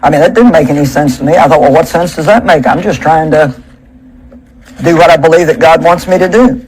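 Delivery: ordinary talk, nothing marked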